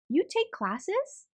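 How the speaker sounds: high, slow, amazed question; surprised question. Acted. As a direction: let the surprise lift every word. Child surprised aunt has classes.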